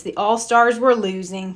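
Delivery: ordinary delivery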